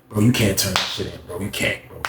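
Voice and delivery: soft, low self-counsel; hushed, sobering second thought; talking himself down. Retold or imitated. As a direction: reason with yourself under your breath.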